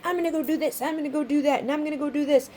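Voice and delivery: mock excited. Someone buzzing with new plans, mock excited. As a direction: bounce through each plan like Knight of Wands. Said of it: "excited about things".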